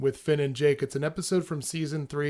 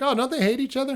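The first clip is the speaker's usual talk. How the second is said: high surprised voice; 11 semitones higher, 4 dB louder, faster than usual. Retold or imitated. Voices Finn.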